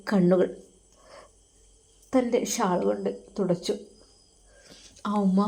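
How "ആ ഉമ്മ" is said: usual speaking voice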